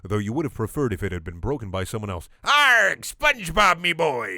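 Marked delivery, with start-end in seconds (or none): 2.4-4.4 higher, louder crab pirate voice; growly pirate greeting; Mr. Krabs pirate voice. Acted. Crab boss greeting his employee, pirate voice.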